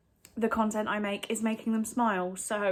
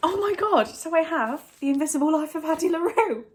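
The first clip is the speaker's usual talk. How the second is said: higher and louder, thrilled at a gift; real delight, higher and louder; excited; 7 semitones higher, 5 dB louder than usual. Real, not acted. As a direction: gush over the book you just unwrapped. Unwrapping gift book.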